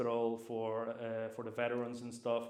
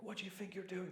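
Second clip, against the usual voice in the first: mock bollocking; 8 semitones higher, 8 dB quieter than usual. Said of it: "they gave me a massive bollocking".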